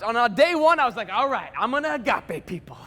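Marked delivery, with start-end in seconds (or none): 1.1-2.8 eager announcement with swooping pitch; pumped up. Retold or imitated. Student setting out to love the school, pumped. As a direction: launch into it with gung-ho resolve. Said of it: "I was pumped".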